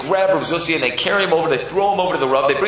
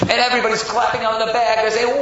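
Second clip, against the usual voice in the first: ordinary delivery, nothing marked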